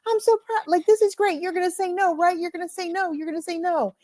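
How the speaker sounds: high, eager imitation of a grinning supporter; smiley, proud guy. Smiling wrestler behind Cody as he answers, gushingly proud. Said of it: "he's behind him and he's smiling".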